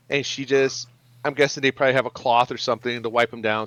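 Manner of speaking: normal talking voice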